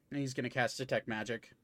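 ordinary talk, nothing marked

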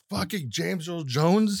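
high, drawn-out incredulous reaction; amazed disbelief. Retold or imitated. Recalling his reaction to the credits, high and slow with disbelief. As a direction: stretch it out in high-pitched astonishment.

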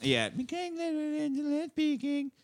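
high slow echo. Pretend. Host echoes friend's name joke, high and slow. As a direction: stretch it out high and playful. Echoing the quip, higher.

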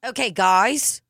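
put-on practised Australian accent, rising at the end. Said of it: "with the, uh, Australian accent".

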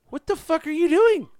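high, loud indignant cry; mock outrage. Friend glaring at Luke after the betrayal, in high mock outrage. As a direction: yelp it in comic disbelief.

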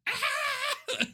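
short high shriek of a scream; crazy scream. Retold or imitated. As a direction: shriek it like a horror movie. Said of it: "She has, like, this crazy scream".

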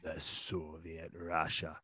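slow, low, soft mock-Russian; bad Russian accent. Co-host riffing on the Soviets, bad Russian accent. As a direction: put on a hammy Russian accent, drawn out. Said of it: "I'm really bad with accents".

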